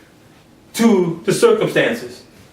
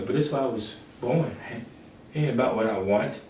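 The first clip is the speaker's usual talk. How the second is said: much lower, much softer, hushed resolve; Jesus softly accepting the cross; resigned Jesus; 8 semitones lower, 12 dB quieter, faster than usual. Acted. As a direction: quietly accept what must be.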